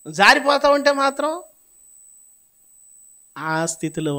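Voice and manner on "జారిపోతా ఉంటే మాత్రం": sudden high, loud cry; loud outburst. Real, not acted. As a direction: burst out over the congregation. Preacher bursts out loudly. (గట్టిగా అరిచి చెప్పడం)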